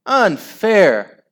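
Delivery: high, loud outrage; howl. A narrator's reading. Losing team objecting to the goal, howling. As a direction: howl in protest. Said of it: "howled Margaret's team".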